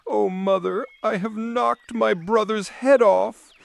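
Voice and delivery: mild distressed Marlene confessing to her mother; upset little girl. Acted. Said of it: "began crying and screaming".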